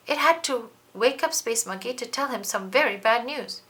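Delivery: ordinary delivery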